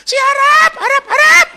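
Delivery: high, loud frantic yell; screaming that the wallet is gone; panicked scream. Retold or imitated. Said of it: "Dia teriak". (teriakan panik yang keras dan tinggi)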